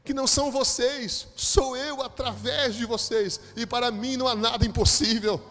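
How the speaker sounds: loud, high, drawn-out preaching; God's thunderous declaration. Retold or imitated. Preacher voices God answering him, thunderous and fervent. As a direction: proclaim it with mounting force to a loud peak.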